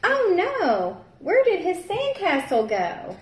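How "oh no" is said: plain reading voice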